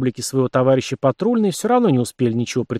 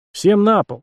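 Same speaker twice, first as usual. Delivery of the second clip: loud, higher shouted order; harsh barking commands; 8 semitones higher, 4 dB louder, slower than usual. A narrator's reading. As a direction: command them to the floor. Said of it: "скомандовал Март". (громко скомандовал)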